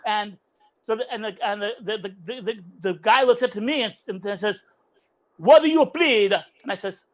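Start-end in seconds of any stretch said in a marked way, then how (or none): 5.4-6.3 loud, raised judge's demand; imitated judge. Judge looking up at the accused, loud mock demand.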